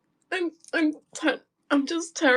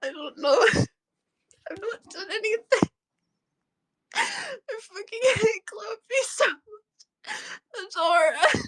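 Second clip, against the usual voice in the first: real tearful outburst, high and strained by sobs; crying hard, words dragged out; 5 semitones higher, 3 dB louder, slower than usual. Real, not acted. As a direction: sob the words out in bursts. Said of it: "I'm just crying".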